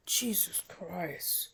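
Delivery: slow, breathy whispered groan of disbelief; exasperated whisper. Real, not acted. Ranting vlogger, fed up with fans, whispers slowly.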